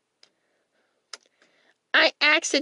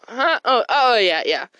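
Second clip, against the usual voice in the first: ordinary talk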